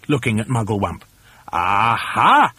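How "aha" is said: theatrical drawn-out exclamation; gleeful cry of triumph; triumphant cry. A narrator's reading. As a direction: announce a cunning plan with relish. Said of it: "cried Mugglewump".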